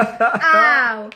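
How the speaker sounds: a laughing, hammed-up cry of pain; laughing mock ow. Wife acting out husband's rough play, laughing mock ow. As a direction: yelp ow through a laugh.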